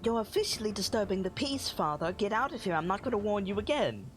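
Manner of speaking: high, quick-talking stern daughter; Estrid. Acted. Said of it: "and she says".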